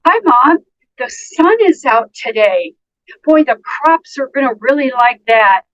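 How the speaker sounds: high, bright friendly hello; upbeat hello to mom; cheerful greeting. Shown as a demonstration. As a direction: greet her sunnily.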